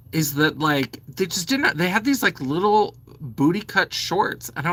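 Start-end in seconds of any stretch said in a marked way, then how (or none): none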